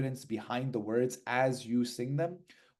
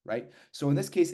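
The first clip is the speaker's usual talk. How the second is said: ordinary talk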